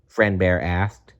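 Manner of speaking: usual speaking voice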